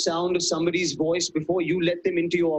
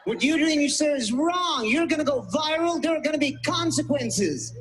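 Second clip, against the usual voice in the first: righteous activist; 6 semitones higher than usual. Acted. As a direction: play the self-righteous bystander filming on his phone, lightly hammed. Mocking righteous American filming wrongdoing.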